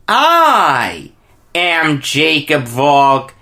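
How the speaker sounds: big booming show-host welcome, drawn out; announcer voice. Acted. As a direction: open the show like a sports announcer. Show host opening greeting.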